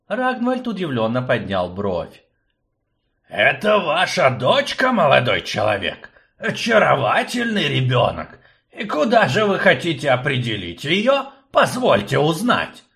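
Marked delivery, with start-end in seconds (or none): 3.3-12.8 put-on high, loud, fussy old professor; fussy old man. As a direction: play the fussy old rector. Akcium fawning over child.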